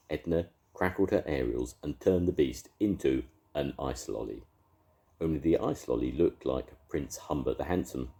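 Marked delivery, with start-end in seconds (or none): none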